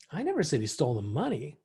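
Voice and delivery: "money" saved for the emphasis at the end; stress on MONEY. Shown as a demonstration. Trainer showing how inflection changes meaning, stressing "money". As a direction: land the weight on "money", pitch moving on it. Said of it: "the inflection of your voice".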